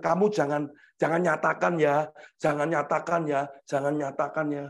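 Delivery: father's warning. Retold or imitated. Father telling child not to confess love, low soft warning. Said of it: "Dan ketika saya bilang".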